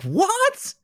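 a squeaked mock realization; mock shocked what. Mocking Dave's sudden realization, a startled high what. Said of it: "Dave's big realization is like".

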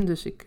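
hard keel schrapen, dan verder praten. Real, not acted. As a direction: schraap je keel luid voor je spreekt. Kucht, vlak voor slokje water. (luid keelschrapen)

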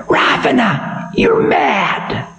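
high, ominous voice of Time; stern ancient Time. Acted. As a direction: warn the demon of doom. Time's high voice prophesies.

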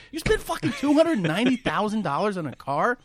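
loud, high disbelief; incredulous. Retold or imitated. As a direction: shout the price back in disbelief. Friends incredulous at the price.

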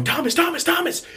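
high-pitched, urgent calling of a name; alarmed mom. Retold or imitated. Mom shaking child awake, alarmed calling. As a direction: imitate a worried mother calling out.

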